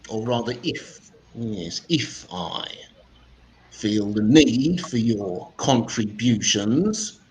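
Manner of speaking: high, slow, pompous schoolmaster; posh physics teacher. Acted. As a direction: talk down to the class like a stuffy teacher. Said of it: "This is my impersonation".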